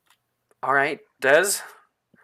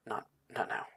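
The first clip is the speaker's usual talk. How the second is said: soft, hushed and fast; leaning in quietly; 14 dB quieter, faster than usual. Acted. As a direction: murmur it close so nobody hears. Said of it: "lean over toward you and say".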